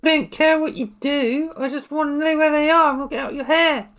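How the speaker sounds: impatient. Acted. Gertrude pressing the barman for the caves, high and loud. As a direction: lean on him, fed up.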